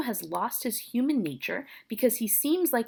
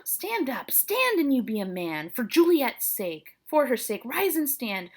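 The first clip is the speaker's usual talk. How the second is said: higher-pitched theatrical lament, turning to urging; fretful nurse; 5 semitones higher, 3 dB louder than usual. Acted. Nurse lamenting over Romeo and urging him up, dramatic. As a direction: wail a little, then urge him up.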